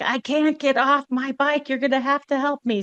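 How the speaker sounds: pleading. Retold or imitated. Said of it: "I'm like".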